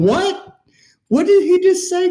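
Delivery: high-pitched, incredulous retold reaction; stunned disbelief. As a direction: act out a baffled "what just happened". Stunned players' reaction retold as a quote.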